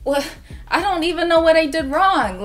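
panicked bewilderment, pitched high; mock-helpless protest, high and rising in volume; retold freak-out. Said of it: "so I'm freaking out".

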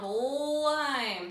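"lime" with a drawn-out l; first sound stretched. Shown as a demonstration. Teacher repeating the first sound, drawn-out l on "lime". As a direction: hold the opening l of "lime". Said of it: "Ol's the first sound".

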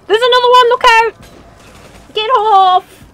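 high, loud alarmed yelling; panicked shout. Real, not acted. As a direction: shout a frantic warning. Attacked by monsters in game.

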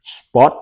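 "Spot." với âm t cuối bật rõ; nói "Spot." bật rõ âm t cuối, giọng hơi cao; clear final t. Shown as a demonstration. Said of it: "Phải có chữ thờ ngoài sau". (phát âm "Spot." bật rõ t cuối)

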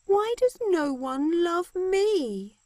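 forlorn lament. Acted. Hermia left alone while the men fight over Helena, slow and plaintive. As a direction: wail it like a girl nobody loves.

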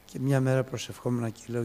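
normal talking voice

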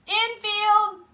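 high, loud field call; shouted call. A narrator's reading. Kid directing players on the field, high shout. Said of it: "shouted Damien".

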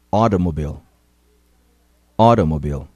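Automobile with the T tapped like a quick D; flapped T. Shown as a demonstration. Teacher models Automobile twice, flapped T. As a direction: say Automobile as awdomobile. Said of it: "Ouça e repita".

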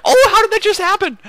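high, loud frustrated shout; screaming player. Retold or imitated. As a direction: yell it like a stunned player. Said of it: "screaming at his team".

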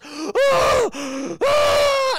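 loud, very high scream of agony; agonized mock scream. Guard being microwaved alive, hammed-up agonized screams.

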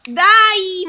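loud, high, drawn-out complaint; indignant burst at the game; exasperated protest. Real, not acted. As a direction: wail it out in frustration. Player protests the failed sequence.

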